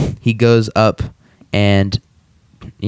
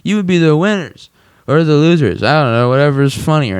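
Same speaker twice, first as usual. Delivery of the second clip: high-pitched mock stage heckling; raised pitch, easing down toward the end; Peter; 6 semitones higher than usual. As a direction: lightly imitate a cartoon drunk comic. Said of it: "He's like".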